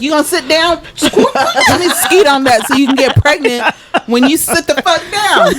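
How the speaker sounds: high-pitched, laughing mock order; mock bossy donor. Imagining a celebrity donor ordering someone around, mock bossy.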